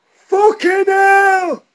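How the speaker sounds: loud, long high yelled curse, slowed right down; first-try yell. Retold or imitated. Retelling a skateboard fall to a friend, loud drawn-out yell. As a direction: bellow it as if you've just split your chin. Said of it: "in a higher pitched voice than that".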